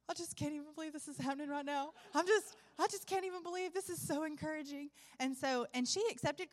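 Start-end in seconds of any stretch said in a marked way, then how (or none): 0.1-4.9 higher, overcome voice of a crying woman, retold; imitated tearful disbelief. Woman overwhelmed at being led to Christ, imitated tearful disbelief. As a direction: act out her tearful amazement, pitched up. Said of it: "she's got tears streaming down her face, and she's shaking".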